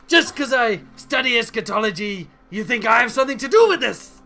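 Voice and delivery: indignant shout in a put-on accent. Harassed friend shouting at police officers, loud accented protest. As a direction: raise pitch and volume, protesting to police. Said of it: "he's busy shouting at people nearby".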